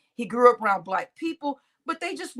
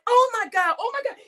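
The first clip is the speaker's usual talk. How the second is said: panicked; 8 semitones higher than usual. Pretend. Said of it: "You'd be like".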